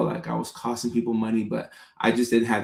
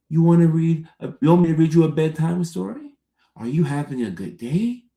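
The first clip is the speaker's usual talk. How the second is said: put-on high, sing-song voice for talking to a baby; 5 semitones higher, 3 dB louder than usual. Shown as a demonstration. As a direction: coo questions at a baby, pitch sweeping upward. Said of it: "we talk to babies like that".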